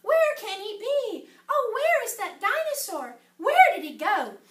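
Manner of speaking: lively storybook voice, swinging pitch; story reading to children. A narrator's reading. Reader telling children about the dinosaur ride, animated storytelling. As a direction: perform each character's line for young listeners. Reads picture book aloud to children.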